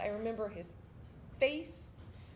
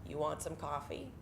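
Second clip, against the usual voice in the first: dropped, softer deadpan question; low, casual offer; 8 semitones lower, 5 dB quieter than usual. Retold or imitated. Drill sergeant sipping coffee makes an offer, quietly. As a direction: offer it low and quiet after a long pause.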